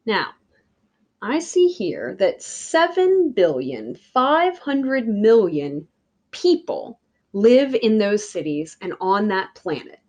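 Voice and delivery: usual speaking voice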